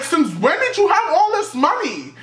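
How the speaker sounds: very high, mock-astonished questioning; hammed-up high-pitched callout; incredulous squeal. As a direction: squeak it out in exaggerated disbelief. Acted challenge to suddenly flashy friend.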